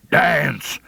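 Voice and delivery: hard mock call across the saloon; mean and hard. Scritch calls Ringo out in the saloon, mean and hard. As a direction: bark the name out mean and hard. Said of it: "mean and hard like".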